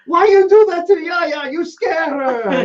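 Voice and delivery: hammed-up furious Greek owner; angry Greek yell. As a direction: scold Bob for scaring Yaya. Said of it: "the guy starts yelling at Bob".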